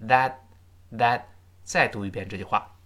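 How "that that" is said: "that" cut off with the final t unreleased; fast-speech unreleased t. Shown as a demonstration. Teacher showing how that sounds read fast, unreleased t. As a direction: say "that" with a silent, held-back t. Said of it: "读快是".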